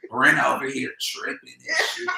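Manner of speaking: ordinary talk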